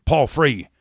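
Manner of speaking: ordinary talk, nothing marked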